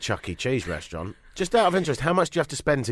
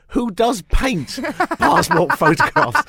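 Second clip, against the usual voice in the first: laughing disbelief; 14 semitones higher, 7 dB louder, slower than usual. Retold or imitated. Mocking a caller's confusion.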